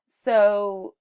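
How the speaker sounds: ordinary talk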